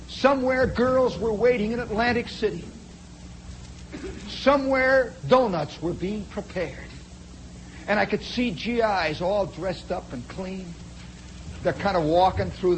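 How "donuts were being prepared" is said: ordinary delivery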